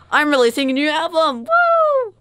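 high, loud triumphant announcement ending in a whoop; excited announcement. Retold or imitated. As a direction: crow it like a pop star's big comeback. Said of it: "it's just like".

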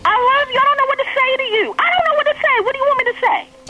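high-pitched, distressed pleading; real upset, pitch shooting up; upset yelling. Real, not acted. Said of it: "why are you yelling".

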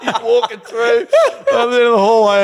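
high, loud, helpless laughter over the words; laughing. Real, not acted. Recalling his boss covered in mozzie bites, laughing helplessly. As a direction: crack up until it nearly turns to tears.

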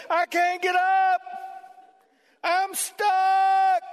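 high, slow, shouted sheep cry; stuck sheep crying. Pretend. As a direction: yell it like a sheep that can't get up.

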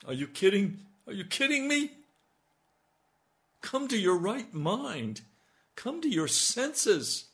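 high, loud panicked voice; frantic panic over a lost phone. Retold or imitated.

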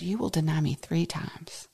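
soft, whispered prophecy. Acted. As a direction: whisper Jesus' warning. Jesus' words whispered.

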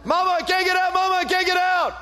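high, loud desperate wail for mama; a locked-in boy begging his mother, frantic; child calling for mama. Retold or imitated. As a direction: cry out like a trapped toddler. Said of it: "He's freaking out".

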